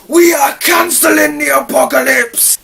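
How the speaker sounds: huge, high, slow battle-cry shout; rousing speech shout. Acted. Reviewer acting out Idris Elba's speech, rousing shout. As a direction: bellow it out to rally the troops. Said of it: "his speech was pretty rousing".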